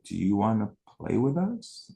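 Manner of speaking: mock sweet, slow kid-talk; sing-song talking down to an odd kid; high sugary talk to a stranger; talking down to an alien. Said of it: "they literally would talk to me like I was an alien".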